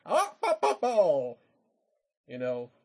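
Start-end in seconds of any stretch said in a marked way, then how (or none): 0.0-1.3 loud, high nonsense yelps; Jim Carrey. Retold or imitated. Mimicking a comic's over-the-top movie antics, loud wacky yelping. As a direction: blurt mock zany "bah" noises, starting high and dropping. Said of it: "he just started going".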